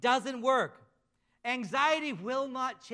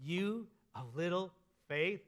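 drawn out slowly, softer towards the end; slow rhetorical question; 3 semitones lower, 4 dB quieter, slower than usual. Retold or imitated. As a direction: lay the question down slowly and gently. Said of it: "He says".

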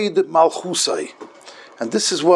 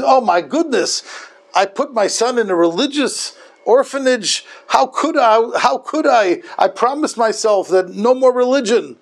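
higher-pitched, mildly acted dismay; dismayed mother; 6 semitones higher than usual. Mother finding her son in a religious orphanage, dismayed. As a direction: imitate a mother upset with herself.

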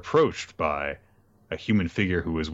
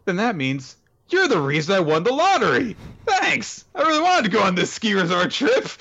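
put-on high, fast, chirpy girl voice; bubbly girl; 12 semitones higher, 4 dB louder than usual. Cheerful girl thanking the villain for her lottery win, high and bright. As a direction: squeak it out like a delighted oblivious girl. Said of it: "Ruru's like".

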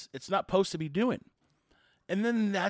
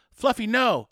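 loud, higher scolding; mock yelling owner; 6 semitones higher, 8 dB louder, slower than usual. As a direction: yell at the dog like a flustered owner. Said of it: "The owner's going".

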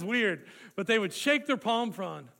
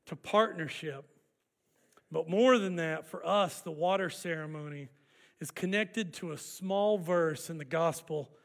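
ordinary talk, nothing marked

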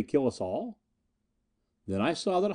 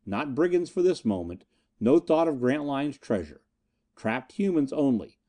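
plain reading voice

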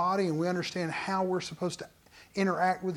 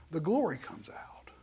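hushed whisper; 2 semitones lower, 8 dB quieter than usual. Real, not acted. Pastor closing the point on belief in a whisper. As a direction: breathe it out reverently.

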